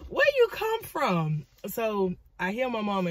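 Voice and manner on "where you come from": high, drawn-out incredulous call; mock bewilderment. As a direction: ask it high and slow, baffled. Startled reaction to mama appearing.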